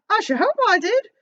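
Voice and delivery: put-on high call in a street-boy accent; boy's accent. The boy hollering back up to Scrooge. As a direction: shout back like a young lad. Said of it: "doing an accent for him".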